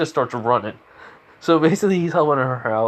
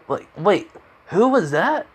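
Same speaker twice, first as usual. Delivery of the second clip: high, surprised double-take; acted astonished question; startled; 8 semitones higher, slower than usual. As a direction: ask with pitch leaping up.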